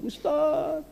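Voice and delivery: high, tearful call to the teacher; distraught mother calling the preacher; tearful plea. Retold or imitated.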